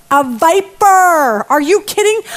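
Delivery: aghast, voice jumping up; shocked disbelief. Acted. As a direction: react in stunned disbelief, stretching it out. Acts shocked reaction to snake.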